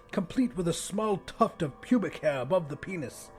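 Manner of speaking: usual speaking voice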